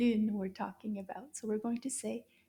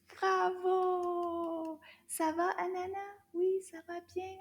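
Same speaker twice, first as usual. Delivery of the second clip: puppet voice; 6 semitones higher than usual. Acted. Puppet voice for Anana.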